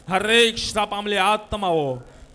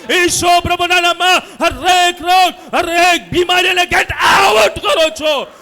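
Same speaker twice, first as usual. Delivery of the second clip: chant-like preacher's shout, building higher; forceful, stretched words at full voice; loud declaration; 7 semitones higher, 8 dB louder than usual. Real, not acted. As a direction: lead the crowd in shouted healing declarations. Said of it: "ઊંચા અવાજે કહો".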